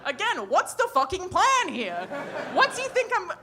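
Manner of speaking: exasperated bafflement. Acted. Comedian questioning a sender's fake picture, loudly incredulous. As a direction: demand to know, utterly baffled.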